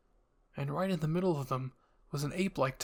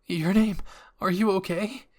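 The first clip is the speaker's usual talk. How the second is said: worried; 5 semitones higher, 6 dB louder than usual. A narrator's reading. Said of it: "he asked".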